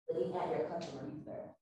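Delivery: ordinary talk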